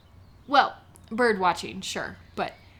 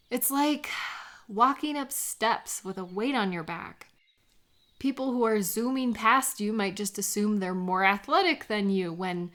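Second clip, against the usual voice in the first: normal talking voice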